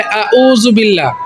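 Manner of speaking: "আউযু বিল্লাহ" unchanted, both first vowels plain hamza; ayn said as hamza. Retold or imitated. Teacher showing a mistake, "আউযু বিল্লাহ" with hamza for ayn. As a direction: say "আউযু বিল্লাহ" with no throat sound on "উ". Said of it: "দ্বিতীয়টাও হামজা বলে".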